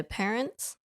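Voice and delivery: nervous crack on the last word. Real, not acted. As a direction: voice cracks on the question. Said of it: "your voice cracked there".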